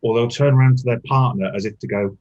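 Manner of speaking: normal talking voice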